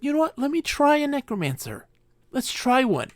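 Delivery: eager newcomer. Pretend. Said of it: "being a good race and be like".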